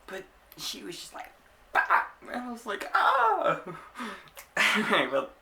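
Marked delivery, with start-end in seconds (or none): 1.7-2.0 loud, abrupt "bah" burst; imitated punch noise. Acting out the girl's punch for viewers, loud burst. 2.9-3.6 high, loud mock scream; mock pained yelp. Retelling being hit by the girl, high mock yelp. Imitates own cry when hit.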